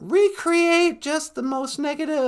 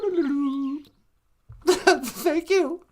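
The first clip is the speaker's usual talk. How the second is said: ordinary talk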